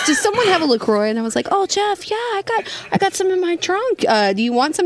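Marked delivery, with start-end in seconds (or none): 0.0-1.1 mock Jeff request. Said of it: "he goes". 1.5-4.9 high, sugary mock of Renee. Jeff mocking Renee offering him LaCroix, high mock voice. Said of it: "That is not how I sound".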